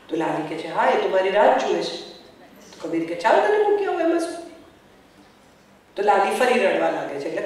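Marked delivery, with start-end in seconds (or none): 3.2-4.4 high, fast and carefree; breezy Kabir. Retold or imitated. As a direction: toss off the offer like it's nothing. Kabir offers to take her.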